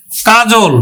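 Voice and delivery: normal talking voice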